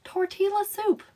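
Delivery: Tortilla soup said high and soft in her Southern accent; a breezy Southern-accented Tortilla soup; a light Southern take on Tortilla soup. Shown as a demonstration. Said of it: "The way I say tortillas".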